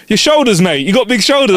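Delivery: high, loud clarifying stranger; imitating the dude. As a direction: pitch it way up to explain himself. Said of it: "He's like".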